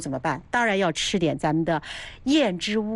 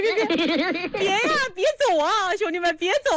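much higher falsetto pleading with giggles; clingy landlady; 12 semitones higher than usual. Pretend. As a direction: beg the brothers not to leave, half laughing.

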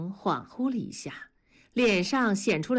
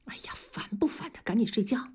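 irritated; 2 semitones higher, 5 dB quieter, faster than usual. A narrator's reading. Wife shoving husband away in bed, irritated whisper. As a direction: push him away with a whispered grumble. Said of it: "烦躁地说".

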